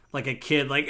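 ordinary talk

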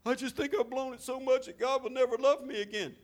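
high, mock hopeless sigh; mock despair. Pastor voicing a hopeless believer, high and mocking. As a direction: play the defeated believer. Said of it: "woe is me".

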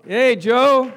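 loud, high call across the room. Real, not acted. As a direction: call him up to the front. Calling a man up front.

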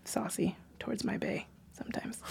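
playful whispered confession. Real, not acted. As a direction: whisper it like a secret. Confessing aside about her partner.

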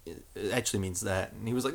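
ordinary talk

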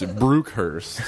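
Brookhurst with the double O exaggerated; double-O mispronunciation. Shown as a demonstration. Joking about double-O words with friends, exaggerated mispronunciation. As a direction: play up the oo in "Brookhurst". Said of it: "every time we saw something with a double O".

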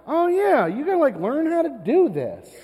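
high, loud, cocky coaching voice; mock know-it-all dad. Dad bluffing expertise to his son, mock confident. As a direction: play the dad who thinks he knows baseball.

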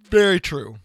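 talking through a yawn; stretched yawn-filled words. Real, not acted. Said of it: "Sorry, I'm yawning".

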